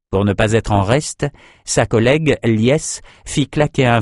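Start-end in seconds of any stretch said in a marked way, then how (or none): none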